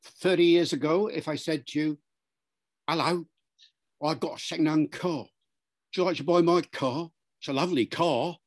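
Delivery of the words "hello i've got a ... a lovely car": London Cockney car seller; broad Cockney sales patter; Cockney accent. Shown as a demonstration. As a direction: pitch it like a Cockney dealer, h dropped. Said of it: "A Cockney accent from London".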